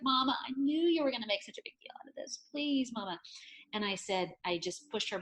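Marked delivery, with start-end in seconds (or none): 0.0-3.2 higher, quick exasperated plea; imitated pleading daughter. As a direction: play a daughter begging Mom to stop fussing. Mother voices embarrassed daughter pleading.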